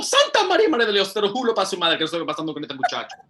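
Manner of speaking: high fast exasperated Spanish outburst; exasperated teacher. Retold or imitated. Spanish teacher fed up with the gringo student, fast rant. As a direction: throw up your hands like the fed-up teacher.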